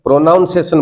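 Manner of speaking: प्रोनाउंसिएशन said with "noun" in the middle; mispronounced pronunciation. Shown as a demonstration. Teacher showing students their common mistake, mispronouncing प्रोनाउंसिएशन with "nown". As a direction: put a "nown" sound inside प्रोनाउंसिएशन. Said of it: "आप में से बहुत सारे लोग".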